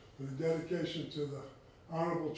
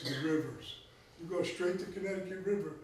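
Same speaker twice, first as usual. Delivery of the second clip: normal talking voice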